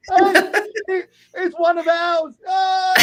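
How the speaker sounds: very high, drawn-out cry of recognition; acted joyful outcry; overjoyed exclamation. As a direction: whoop as if finding long-lost family. Acts out stranger's joyful recognition.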